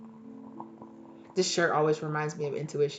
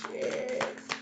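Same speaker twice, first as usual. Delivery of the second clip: whispered curse; 5 dB quieter than usual. Retold or imitated. Retold reaction while studying.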